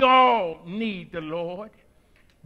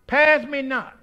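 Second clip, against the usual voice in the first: shouted plea; 3 semitones higher, 4 dB louder than usual. Acted. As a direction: cry out to the Savior. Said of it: "That's the time to shout out".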